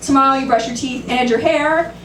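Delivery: usual speaking voice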